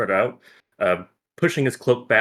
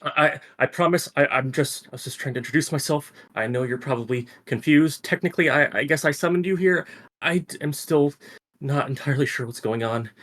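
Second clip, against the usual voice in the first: higher-pitched, stammering reassurance; flustered explaining; 6 semitones higher than usual. Acted. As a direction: stumble through a nervous explanation. Noah nervously explains himself after mishap.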